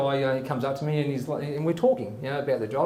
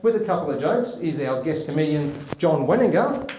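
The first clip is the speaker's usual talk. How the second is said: announcer; 3 semitones higher, 3 dB louder than usual. Acted. Host announces guest comedian.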